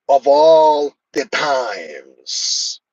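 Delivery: very slow, stretched-out catchphrase impression; Santino Marella. Streamer repeating a wrestler's line to viewers, drawn-out impression. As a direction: drag out ALL and TIMES to a long final s. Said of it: "That's how Santino Marella would say it".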